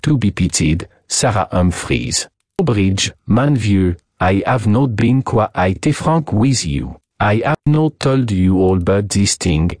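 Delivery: put-on heavy French-accented English; thick French accent, deliberate and theatrical; voluble French detective, earnest. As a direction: explain it as a courtly, confiding Frenchman.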